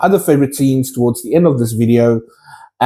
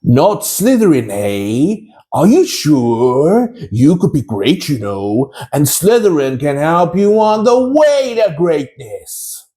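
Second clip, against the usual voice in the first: Sorting Hat voice; 2 semitones higher, slower than usual. Retold or imitated. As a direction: drawl the doubts, then burst out the house name. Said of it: "my impression of the famous Sorting Hat voice".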